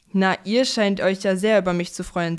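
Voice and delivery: ordinary delivery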